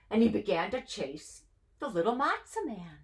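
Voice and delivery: ordinary delivery, nothing marked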